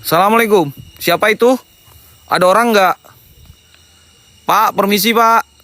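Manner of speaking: loud, high call into the darkness. Real, not acted. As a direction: call out to whoever might be there. Calling out into dark place.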